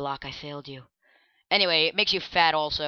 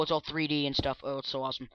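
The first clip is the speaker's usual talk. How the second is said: ordinary delivery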